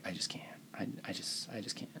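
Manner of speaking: a sarcastic, dramatic hush of defeat; dramatic whisper. Retold or imitated. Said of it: "overly dramatic in a, like, sarcastic".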